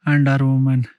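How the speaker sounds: speaking up over wind; loud, low narration outdoors. Real, not acted.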